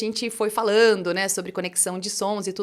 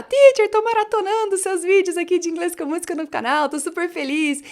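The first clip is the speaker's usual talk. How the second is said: higher, louder gushing imitation of a fan; excited fan message; 7 semitones higher, 8 dB louder than usual. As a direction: gush like a thrilled follower writing in. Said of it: "o pessoal lá sempre manda mensagem que tá".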